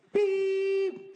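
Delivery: high, loud beep sound; imitated machine beep.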